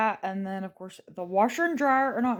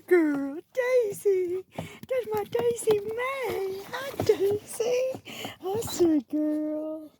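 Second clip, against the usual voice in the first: high pet talk; 11 semitones higher, slower than usual. Real, not acted. Doting on the neighbor's dogs, slow high cooing.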